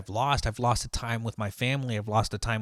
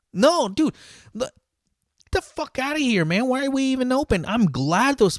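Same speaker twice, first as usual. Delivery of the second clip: outraged rant; 13 semitones higher, 7 dB louder than usual. Real, not acted. Outraged at being called in.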